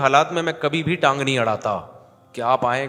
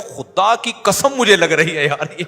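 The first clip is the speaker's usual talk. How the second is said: desperate pleading; 4 semitones higher, 6 dB louder than usual. Retold or imitated. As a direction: beg him to stop hitting, swearing it hurts. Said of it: "کہہ رہا ہے".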